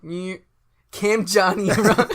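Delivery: loud, high swoop through the names; Doppler effect redo. Shown as a demonstration. Correcting his friend's effect with a loud Doppler swoop. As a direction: blast the names as if they fly past. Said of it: "It would've been like".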